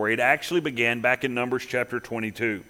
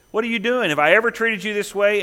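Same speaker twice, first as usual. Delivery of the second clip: higher, loud mock outrage from a wronged donkey; a donkey protesting being kicked, lightly voiced; indignant donkey; 6 semitones higher, 3 dB louder than usual. Donkey scolding its rider after being kicked, in mock indignation. Talking donkey complains to Balaam.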